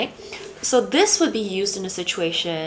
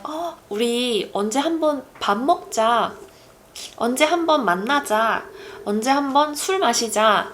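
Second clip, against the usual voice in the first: higher, quick friendly invitation; breezy empty promise; 4 semitones higher, much faster than usual. Acted. Meeting an old friend, breezy empty promise. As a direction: toss out casual plans you won't keep. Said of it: "an old friend and you're like".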